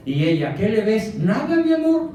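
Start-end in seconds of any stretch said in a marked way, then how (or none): 0.5-1.2 higher, louder jealous demand; jealous wife. Acted. Acted wife jealous at husband. 1.2-2.1 much higher, louder squeaky denial; caught husband. Acted. Acted husband denying to wife.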